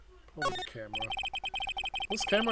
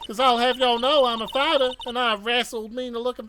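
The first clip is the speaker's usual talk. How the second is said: put-on Southern accent; 8 semitones higher, 6 dB louder than usual. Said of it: "my best Southern Belle voice".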